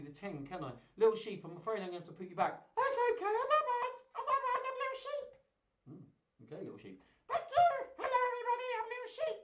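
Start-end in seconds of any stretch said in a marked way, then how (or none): 2.8-5.3 very high tiny sheep voice; little sheep puppet. Pretend. Sheep puppet answers host. 7.3-9.4 very high tiny sheep voice; little sheep puppet. Pretend. Sheep puppet greets everyone.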